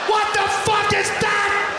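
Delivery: high, slow, drawn-out shout; losing it. Retold or imitated. New father loses it at the doctor, high outraged shout. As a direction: cry it out in outraged disbelief. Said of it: "I lost it".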